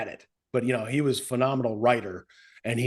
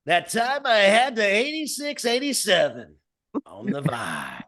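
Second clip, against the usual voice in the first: Al Farb impression; 7 semitones higher than usual. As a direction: sell it like a cheesy DJ. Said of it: "let me do it like Al Farb".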